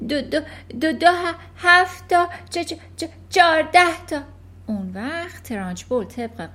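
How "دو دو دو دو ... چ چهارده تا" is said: gasping, high stuttering answer; breathless stammer. Acted.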